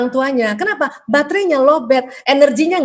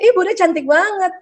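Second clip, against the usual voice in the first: lightly voiced child, higher pitch; a child's admiring compliment, pitched up; 7 semitones higher than usual. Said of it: "gitu ya".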